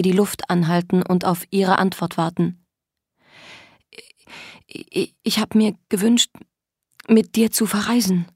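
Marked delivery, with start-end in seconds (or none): 4.7-8.3 stuttering, halting start; nervous stammer. Acted. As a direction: stammer out a hasty excuse. Ängstliche Notlüge, stotternd. (ängstlich stotternd)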